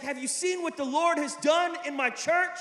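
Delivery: high, loud, gushing pastor; imitated excited boast. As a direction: gush about it like an eager colleague. Retelling a pastor boasting about church.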